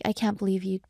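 normal talking voice

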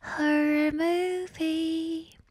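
slow, eerie drawn-out ghostly tone; haunt it like a movie ghost; slow spooky ghost voice. Shown as a demonstration. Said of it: "like a ghost in a horror movie".

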